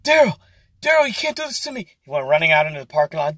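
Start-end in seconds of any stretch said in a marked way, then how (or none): 0.0-1.9 high, frantic, pleading manager; panicked manager pleading. Retold or imitated. Manager begs cook not to leave, high frantic plea. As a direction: squeal it like a boss losing it. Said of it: "Bill lost his mind".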